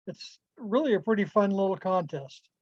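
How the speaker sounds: ordinary talk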